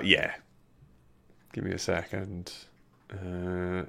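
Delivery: ordinary talk, nothing marked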